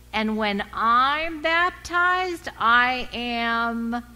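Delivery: high, loud and slow, full of joy; Fayette's joyful cry. Retold or imitated. Fayette telling the new member class, joyfully. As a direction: call it out like a woman thrilled at her coming baptism.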